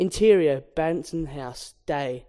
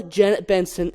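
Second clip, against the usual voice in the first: usual speaking voice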